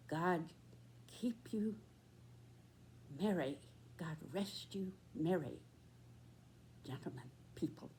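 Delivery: hushed, half-sung whisper; whispered carol. Retold or imitated. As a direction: breathe the carol softly like a night watchman. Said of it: "singing as he went".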